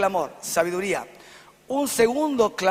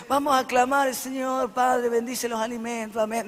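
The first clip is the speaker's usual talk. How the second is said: mock a rattled-off, bored mealtime prayer; bored, casual praying; 2 semitones higher than usual. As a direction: imitate someone praying on autopilot, without any fervour. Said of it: "orar casualmente y aburridamente".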